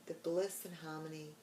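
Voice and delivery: soft, hushed meditation voice with long pauses; guided meditation. Acted.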